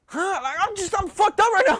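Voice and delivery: high-pitched, rattled disbelief; acted freak-out. He hears something grabbed her ankle, acted freak-out. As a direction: shoot the voice up in alarm. His stunned reaction to her grab.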